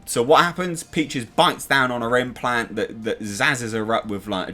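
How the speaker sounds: normal talking voice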